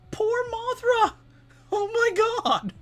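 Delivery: very high squealing disbelief; shocked squeal. Real, not acted. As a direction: shriek it in falsetto outrage. Mock outrage at comic panel.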